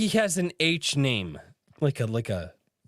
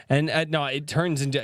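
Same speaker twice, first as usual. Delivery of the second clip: ordinary talk, nothing marked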